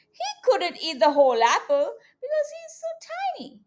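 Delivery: usual speaking voice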